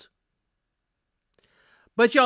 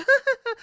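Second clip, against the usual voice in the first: high giggle; 3 dB louder than usual. Retold or imitated. Belinda laughing on the show.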